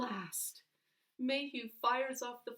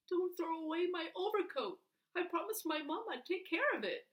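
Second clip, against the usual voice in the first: put-on pleading boy; 9 semitones higher than usual.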